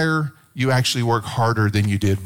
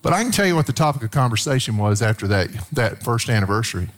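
ordinary talk

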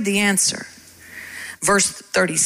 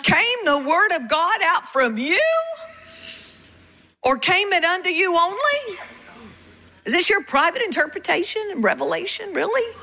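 higher, rising rhetorical questions; incredulous challenge; 8 semitones higher than usual. Acted. Paul rebuking the church at Corinth, incredulous. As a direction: throw it at them in disbelief.

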